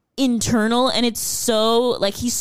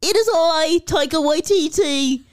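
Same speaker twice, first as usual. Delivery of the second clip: mock New Zealand accent; 3 semitones higher than usual. Mock skit of the two actors.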